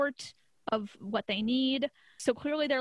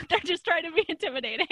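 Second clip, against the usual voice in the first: higher-pitched, quick, through laughter; laughing; 6 semitones higher, 4 dB louder, faster than usual. Real, not acted. Host excusing their bad accent to co-host, laughing. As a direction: laugh your way through the words.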